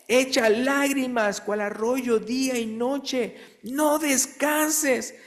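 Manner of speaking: high, slow, impassioned reading; fervent scripture reading. Acted. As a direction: plead the verse slowly and high. Reads Lamentations with fervor. (lectura bíblica ferviente y lenta)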